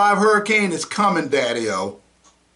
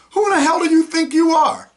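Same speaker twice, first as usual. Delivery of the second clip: shouted high, louder; furious yelled challenge; angry demand; 10 semitones higher, 4 dB louder than usual. Real, not acted. Angry rant at officials.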